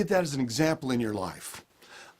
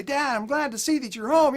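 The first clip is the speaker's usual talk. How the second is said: put-on much higher, bright little-kid voice, louder; eager kid voice; 13 semitones higher, 5 dB louder than usual. Kids greeting their dad at the door, high eager child voice. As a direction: play the happy child running up.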